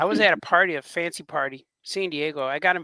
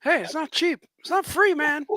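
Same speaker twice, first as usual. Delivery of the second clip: much higher, put-on miser voice; mock cheap boss; 9 semitones higher than usual. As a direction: whine it like a tightwad boss. Joins in mocking the cheap boss.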